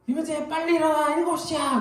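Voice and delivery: put-on high, urgent yell to wake a child; wife hurrying the child awake; wife's yell. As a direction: call him up like a mother in a hurry. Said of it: "목소리들이 막 커지죠".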